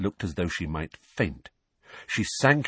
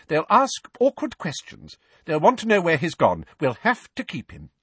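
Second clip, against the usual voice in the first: pitched-up, insistent aunt; brisk, snappish; 8 semitones higher, 3 dB louder than usual. Acted. Aunt worried what neighbours will ask, brisk and snappish. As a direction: lay down the reasons firmly. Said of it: "regaining her usual brisk, snappish manner".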